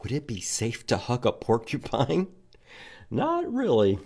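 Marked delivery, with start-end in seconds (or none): none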